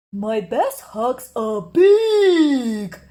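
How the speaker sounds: slow, loud whiny bear cub; drawn-out longing bear cub; little bear voice. Acted. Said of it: "thought Douglas".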